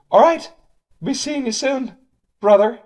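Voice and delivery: very high silly voice, mostly loud; stupid voice. Acted. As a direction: sign off in a silly high-pitched voice. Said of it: "that stupid voice".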